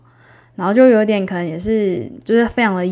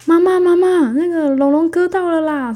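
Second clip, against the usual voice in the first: high, loud panicked call for mom; little brother shouting the news to mom; 10 semitones higher, 6 dB louder than usual. Retold or imitated. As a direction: yell for mom in alarm. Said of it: "赶快冲过去叫".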